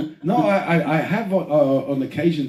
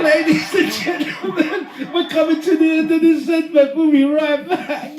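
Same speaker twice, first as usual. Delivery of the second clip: mock TV host; 10 semitones higher, 4 dB louder than usual. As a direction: wrap up the segment like a cheesy host. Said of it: "mock presenter sign-off".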